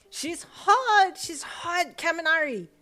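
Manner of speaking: high loud whining wail. A narrator's reading. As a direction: beg a friend to agree. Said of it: "Mineta wailed".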